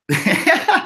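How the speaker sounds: high, sudden laugh; burst of laughter. Real, not acted. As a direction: crack up at the comment. Laughs at coin comment.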